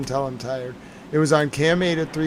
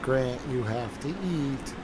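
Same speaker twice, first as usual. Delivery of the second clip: stern chat moms; 2 semitones lower, 10 dB quieter, slower than usual. Retold or imitated. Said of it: "And they're like".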